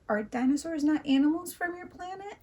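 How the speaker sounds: high, wobbly alien question; warbling alien. A narrator's reading. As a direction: let the voice waver, innocently puzzled. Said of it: "his voice warbled as he spoke".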